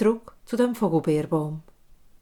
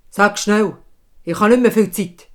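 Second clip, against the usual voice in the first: impatient king; 9 dB louder than usual. Acted. King urges the hag.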